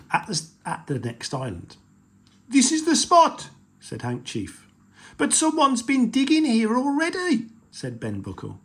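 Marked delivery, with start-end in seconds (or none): 2.5-3.5 high, loud pirate captain; loud high pirate chief announcing the spot. Acted. 5.2-7.5 high, odd pirate voice; a worried pirate grumbling; pirate spotting fresh digging. Acted.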